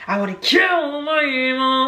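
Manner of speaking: high, slow mock-tragic whine; depressed sad girl. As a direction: mimic a melodramatic depressed girl. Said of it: "that depressed, sad girl".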